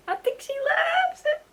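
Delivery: very high, slow, dreamy swoon; hammed-up high romantic swoon; mock swoon. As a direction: sigh it out like a lovestruck girl.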